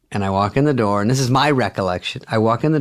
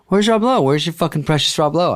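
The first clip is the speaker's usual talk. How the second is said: jealous taunt; 6 semitones higher than usual. Retold or imitated. Farley's jealous taunt escalates.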